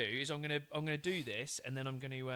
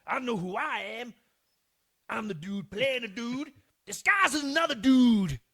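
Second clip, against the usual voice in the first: loud, higher Tropic Thunder impression; film quote impression; 7 semitones higher, 11 dB louder than usual. Shown as a demonstration. Doing a film line for a quiz, loud and higher than usual. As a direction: belt it out like the movie character. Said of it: "I'm gonna try and do the voice".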